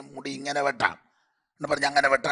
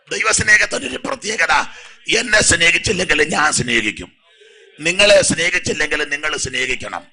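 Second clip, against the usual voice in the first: loud, higher shout from the pulpit; a preacher shouting with fervour; fervent preaching shout; 3 semitones higher, 8 dB louder than usual. Real, not acted. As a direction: proclaim it at the top of the voice. Preacher shouts about God's love.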